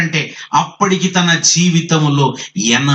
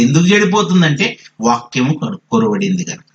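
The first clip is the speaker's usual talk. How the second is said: ordinary delivery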